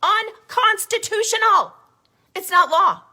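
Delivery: high, loud and drawn out, each syllable hammered; shouted outrage. Real, not acted. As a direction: shout it like a rally cry. Repeated word shouted at climax.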